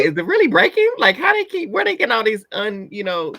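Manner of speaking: high, incredulous; mock disbelief. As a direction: act amazed it's breaking. Mock disbelief at sound effects.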